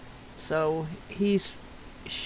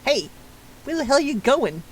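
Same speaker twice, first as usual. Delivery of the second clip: indignant call, higher; calling after; 6 semitones higher than usual. Acted. Character calling after the man as he walks away, indignant. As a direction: shout after the one leaving.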